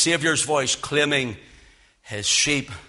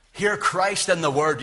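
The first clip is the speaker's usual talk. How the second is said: ordinary delivery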